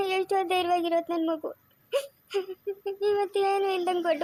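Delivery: tearful, sobbing lament over her daughter; crying lament. Acted. Mother weeps to Savitri over her ruined marriage, crying. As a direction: weep out the guilt of a mother. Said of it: "ಅಮ್ಮ, ಅಳ್ಬೇಡ".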